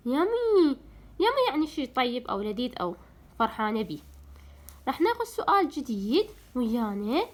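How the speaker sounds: ordinary talk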